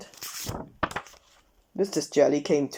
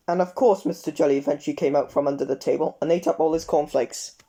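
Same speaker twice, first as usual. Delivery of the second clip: ordinary delivery, nothing marked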